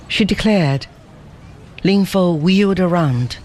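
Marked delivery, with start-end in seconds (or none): none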